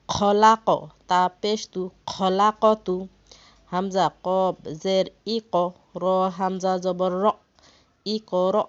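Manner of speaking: loud, spaced letter-by-letter chanting; spelling drill. Shown as a demonstration. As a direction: call out each letter for the class to follow.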